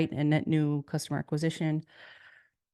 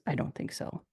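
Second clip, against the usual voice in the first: a breathy whispered "I don't think so"; whispered aside; 2 semitones lower, 8 dB quieter than usual. Real, not acted. Speaker to conference audience, answering her own rhetorical question, in a whispered aside. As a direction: drop to a whisper for the rebuttal.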